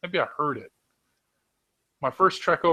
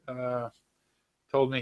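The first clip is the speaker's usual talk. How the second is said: usual speaking voice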